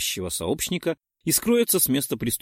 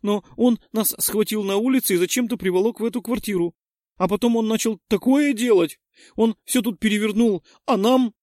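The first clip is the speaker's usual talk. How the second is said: panting shaky eyewitness; 11 semitones higher, 5 dB louder than usual. A narrator's reading. Girl continues fake frightened story.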